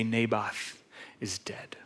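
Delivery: low, very soft death announcement; grave hush. A narrator's reading. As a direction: let it sink quietly, pausing after the name.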